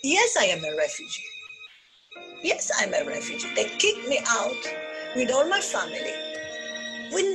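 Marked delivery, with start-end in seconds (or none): none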